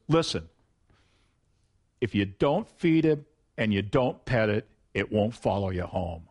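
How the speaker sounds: lightly imitated mother laying down the law; stern mother.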